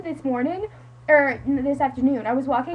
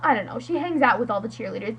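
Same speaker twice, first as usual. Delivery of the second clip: ordinary delivery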